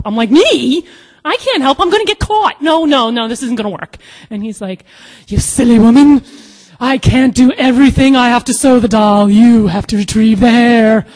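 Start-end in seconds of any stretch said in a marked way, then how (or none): 0.3-4.0 high, loud, comic panic; panicked protest. Retold or imitated. Refusing to help with Johnny's plan, panicked. 5.3-11.1 loud, high theatrical scolding; exasperated scolding. Retold or imitated. Friend ordering her to fetch the hair, exasperated.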